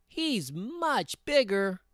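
high, drawn-out little goat; very small voice. A narrator's reading. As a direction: stretch it out in a tiny high voice. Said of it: "in his very small voice".

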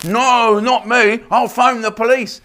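high, loud mock-indignant squeal; hammed-up outraged prude, high and loud; mock prissy offended man.

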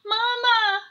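put-on very high, loud child's call; little owl crying out happily; excited cry. A narrator's reading. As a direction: call out for mama. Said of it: "cried Baby Owl".

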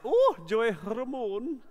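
put-on slow, drawn-out Scottish accent; amateur Scottish accent, savouring the name; attempted Scottish accent. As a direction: have a go at a Scottish storyteller. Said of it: "I can't do a legitimate Scottish accent".

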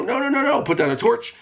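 high squeaky panicked no no no; mock panicked plea. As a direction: squeal it like you're being chased. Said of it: "I go".

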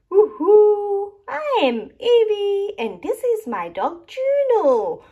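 high, loud gleeful cheer; child explorer whooping hello; excited cheer. Acted. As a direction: burst in bright and high like a thrilled kid. Excited intro of character.

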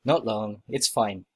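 usual speaking voice

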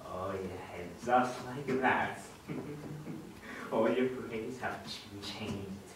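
low, soft, savouring mutter; hushed gloat with soft chuckles; villainous gloating. Acted. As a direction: relish it under your breath. Villain changes grades while gloating alone.

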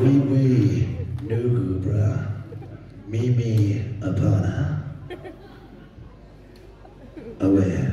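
low, punchy Capetonian chant; hammed-up Rafiki in a Capetonian accent. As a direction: chant like a comic Capetonian mystic. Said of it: "which character shall I do as a Capetonian".

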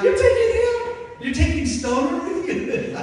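mock incredulous teasing. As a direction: tease in loud disbelief. Incredulous laughing friends.

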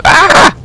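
high, sharp yelp of dismay; a quick high yelp; startled yelp. Real, not acted. Teammate falls off ledge.

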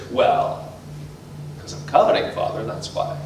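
hushed, guilty little confession; sheepish whisper. Retold or imitated. Caught boy to his father, sheepish whisper. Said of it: "child answers father".

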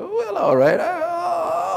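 high, soft, drawn-out wail; hammed-up weepy consent; mock tearful forgiveness. Robbed man giving in to the thief, imitated crying.

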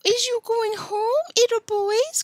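put-on high, drawn-out baby-talk teasing; singsong mock little girl; shrill, girlish voice. A narrator's reading. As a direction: taunt them in a girlish falsetto. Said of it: "calling in a shrill, girlish voice".

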